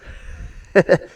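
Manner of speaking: a short voiceless laugh at his own punchline; breathy laugh. Real, not acted.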